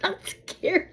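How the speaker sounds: high-pitched mimicry through a laugh; carefree stranger. Stranger approaching a protective dog, high laughing mimicry. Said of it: "She goes".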